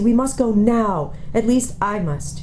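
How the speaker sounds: insist, slowly and resolutely; firm girl. Acted. Young girl telling siblings she must go, slow and firm.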